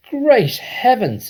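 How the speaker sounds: very high, loud and slow; a flustered father flapping at a giant hen; excited cry. A narrator's reading. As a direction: cry out in wild amazement. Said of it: "he cried, waving his arms".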